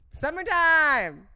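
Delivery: loud, slow, stretched "Summertime!"; excited announcement. Acted. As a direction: sell it like a big reveal. Answering own teaser question.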